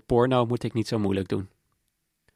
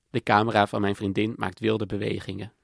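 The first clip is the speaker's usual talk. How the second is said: usual speaking voice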